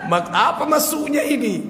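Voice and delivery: high laughing disbelief. Real, not acted. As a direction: burst into laughter while asking. Preacher laughs at the prayer. (tertawa terbahak sambil bertanya)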